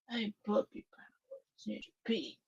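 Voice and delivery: hushed coo, like talking to a pet; gentle hushed pet talk; soft puppy talk. Real, not acted. Cooing to her puppy.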